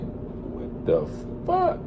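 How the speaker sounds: waking up stunned; stunned what the fuck. Real, not acted. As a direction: whisper it then pop up high.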